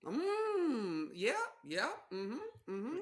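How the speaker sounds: high Midwestern hums of agreement; drawn-out, agreeable Midwest mm-hmms; Midwest accent. Acted. Spouse happily agrees to a beer, high Midwest accent. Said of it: "It's the best accent".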